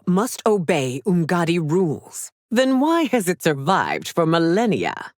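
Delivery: ordinary delivery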